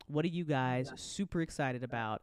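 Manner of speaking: ordinary talk, nothing marked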